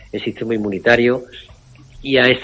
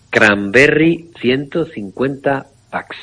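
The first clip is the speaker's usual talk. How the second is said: "Cranberry ciento cincuenta packs" slow, higher, every word stressed; emphatic repeat; 4 semitones higher, slower than usual. Shown as a demonstration. Doctor repeating the product name on air, slow and emphatic. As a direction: drive the product name home for listeners.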